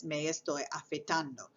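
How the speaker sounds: afeitando with the weight kept on ando, said softly; stress on ando. Shown as a demonstration. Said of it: "putting that stress on that a".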